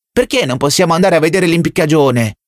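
put-on high, demanding child's shout; roaring boy. A narrator's reading. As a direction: roar it like a spoiled little zealot. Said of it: "ruggì il ragazzino a gran voce". (ruggito acuto di bambino che pretende)

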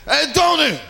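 put-on a tickled gangster hollering for the kid; amused wiseguy bellowing come here; mobster boss. Mafia boss laughing at kid.

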